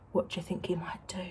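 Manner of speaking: conspiratorial storyteller whisper. Real, not acted. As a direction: lean in and whisper the question. Secret aside to the children.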